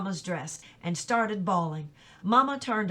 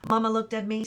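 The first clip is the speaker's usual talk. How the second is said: usual speaking voice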